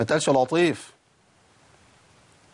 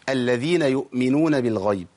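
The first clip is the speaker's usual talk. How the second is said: ordinary talk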